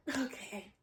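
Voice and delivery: tiny, very soft reply; meek. Retold or imitated. Student told to get in line, meek and quiet. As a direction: give in quietly. Retold meek answer after telling-off.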